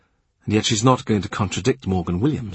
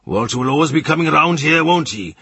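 raised, higher man's voice; Morgan; 7 semitones higher, 5 dB louder than usual. A narrator's reading. Morgan grumbling to Kat about Walter, raised. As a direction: voice Morgan complaining louder.

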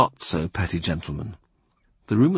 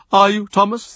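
higher, louder call across the room; 10 semitones higher, 5 dB louder than usual. A narrator's reading. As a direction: call sharply to someone in the corner. Cardinal calls to clerk.

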